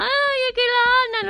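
put-on high, loud crying child complaint; whiny child. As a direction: wail it like a tattling little boy. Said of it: "el niño lloraba".

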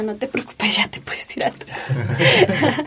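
laughing whisper, no voice at all; playful whispered send-off to her sister; whispered quote. Retold or imitated. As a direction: breathe it with a laugh, like a shared secret. Retelling sending sister home, laughing.

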